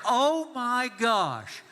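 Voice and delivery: high, slow, drawn-out groan; pained exasperation. Acted. Dad just kneed in the face, pained exasperation. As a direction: stretch it out in mock agony.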